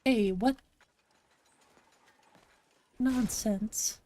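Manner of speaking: ordinary delivery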